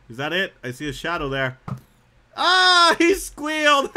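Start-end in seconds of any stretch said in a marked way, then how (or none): 2.3-4.0 high, loud laughing shriek; laughing outburst. Real, not acted. Player killing a squealing bunny, laughing outburst. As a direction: cry out through laughter.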